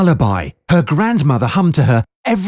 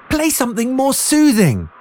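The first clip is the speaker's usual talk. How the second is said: scolding; 9 semitones higher than usual. A narrator's reading. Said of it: "Gemma scolded".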